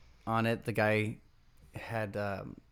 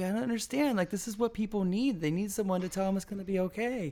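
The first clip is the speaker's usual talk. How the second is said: high whiny pleading voice; imitating the inventor; 10 semitones higher than usual. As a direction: plead it, pitched way up.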